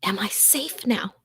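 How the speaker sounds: hushed, trembling question; fearful whisper. Acted. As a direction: barely breathe the question out. Frightened narrator hiding from creature.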